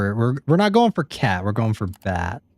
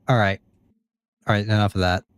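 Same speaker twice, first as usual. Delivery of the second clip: normal talking voice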